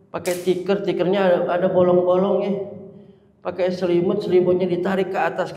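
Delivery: ordinary talk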